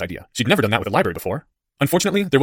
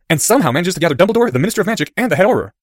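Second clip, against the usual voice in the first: excited schoolboy, high and loud; Harry; 6 semitones higher, 5 dB louder than usual. A narrator's reading. Boy relishing the scandal for his friend, excited. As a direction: pile up the absurd list.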